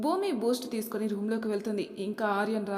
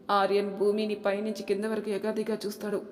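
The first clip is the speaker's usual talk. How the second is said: ordinary delivery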